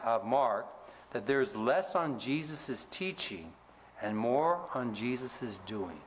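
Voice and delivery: ordinary talk, nothing marked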